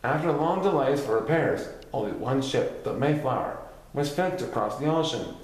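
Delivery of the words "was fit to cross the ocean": ordinary delivery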